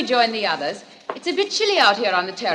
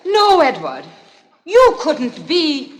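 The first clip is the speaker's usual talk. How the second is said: louder, slowed, shaken denial; frightened disbelief; 6 semitones higher, 5 dB louder, slower than usual. Acted. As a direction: refuse to believe it. Woman realizes he is strangler.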